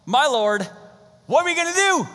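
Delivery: high, loud, panicked cry; panicking servant wailing at the army outside. Retold or imitated. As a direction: shriek it like a terrified servant. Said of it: "The servant starts panicking".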